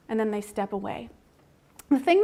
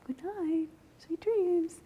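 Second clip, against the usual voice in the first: gentle singsong goodnight; 5 semitones higher, 6 dB quieter, much slower than usual. Retold or imitated. Parent says goodnight to crying baby.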